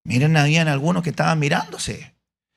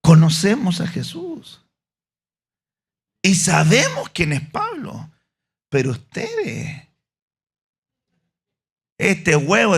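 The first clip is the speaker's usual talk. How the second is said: scornful demon; 8 semitones higher, 4 dB louder than usual. Acted. Demon answers sons of Sceva.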